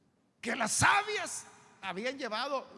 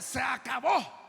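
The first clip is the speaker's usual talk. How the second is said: loud, higher-pitched declaration; emphatic announcement; 4 semitones higher, 5 dB louder, slower than usual. Acted. Preacher voicing the Lord waking disciples, loud and final. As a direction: call time with finality. Lord ends the sleeping, shouted.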